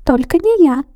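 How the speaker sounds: high squeaky shout; mouse shouting. A narrator's reading. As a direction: cry out not me. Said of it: "закричал один".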